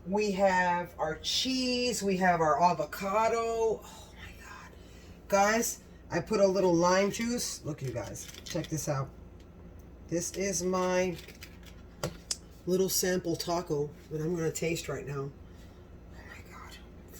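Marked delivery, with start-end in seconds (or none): 3.8-4.7 hushed delight, barely voiced; whispered oh my God of delight; awed whisper. Real, not acted. Admiring finished taco plate. 15.3-17.2 slow, hushed whisper; whispered oh my God before the first bite; excited whisper. Real, not acted. About to taste taco.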